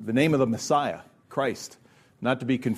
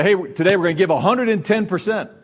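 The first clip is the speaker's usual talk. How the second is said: loud, higher hyped-up coach call; coach's pep talk; 5 semitones higher, 5 dB louder than usual. Retold or imitated. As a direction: fire up the team like a coach. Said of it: "they would say".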